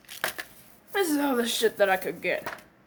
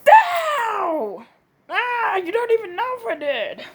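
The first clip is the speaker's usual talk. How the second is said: high loud "ow" then higher protest; pained yelp; 9 semitones higher, 4 dB louder than usual. Acted. Friend getting hit and protesting, high and loud. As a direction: yelp then whine back.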